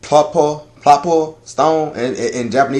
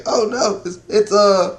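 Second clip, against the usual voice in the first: imitated Rebecca's dismay; 7 semitones higher than usual. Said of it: "she's like".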